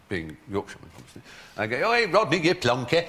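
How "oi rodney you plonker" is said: loud, higher mock-Cockney heckle; bad Cockney accent. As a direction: shout it like a Yorkshireman faking Cockney. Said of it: "rather bad approximation of a Cockney accent".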